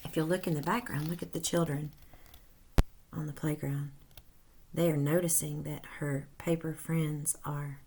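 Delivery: lively read-aloud storytelling voice; animated storybook narration for children; story narration. A narrator's reading. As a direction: read it like a picture book to kids. Reads story aloud to class.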